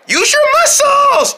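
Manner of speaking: very high, slow, strained squeak; urgent little friend. Pretend. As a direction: squeal encouragement while heaving. Urging each other while carrying.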